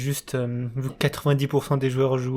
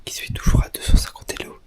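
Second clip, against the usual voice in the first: whispering. Real, not acted. Late night, whispered intro.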